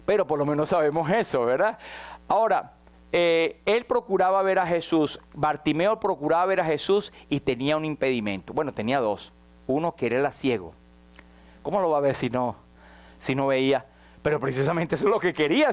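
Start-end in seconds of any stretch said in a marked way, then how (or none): none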